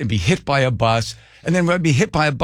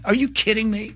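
high-pitched exasperated disbelief; incredulous; 8 semitones higher, 3 dB quieter than usual. Retold or imitated. Reacting to being quizzed on old stories, incredulous. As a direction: throw up the question in comic outrage.

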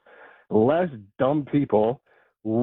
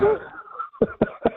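breathless giggling laugh. Real, not acted. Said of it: "Dude, I can't stop laughing".

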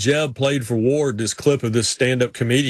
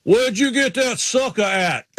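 high, slow Virginia drawl; Ward Burton impression; 7 semitones higher, slower than usual. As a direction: play the country driver, amused and curious. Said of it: "He goes".

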